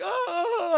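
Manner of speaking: high, loud imitated cry of panic; mock scream. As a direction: yelp like someone fleeing. Merman runs out screaming.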